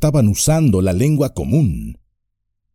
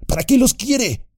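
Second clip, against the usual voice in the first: high squeaky orc voices; shrill goblin question; several orcs asking. A narrator's reading. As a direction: orc rabble demanding. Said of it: "preguntaron varias voces".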